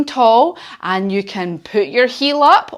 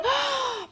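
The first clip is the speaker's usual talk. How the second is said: horse blow; 11 dB quieter than usual. Shown as a demonstration. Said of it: "And if you want to lean forward".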